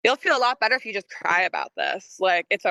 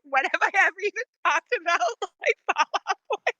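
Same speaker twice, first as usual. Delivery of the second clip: incredulous, laughing; 9 semitones higher than usual. Retold or imitated. Retold own baffled reaction, laughing.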